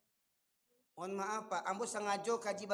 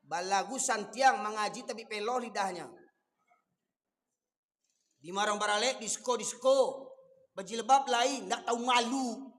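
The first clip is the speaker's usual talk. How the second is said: fiery preaching; 7 dB louder than usual. Acted. Preacher scolding elders at weddings, loud fiery preaching. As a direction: thunder it at the congregation.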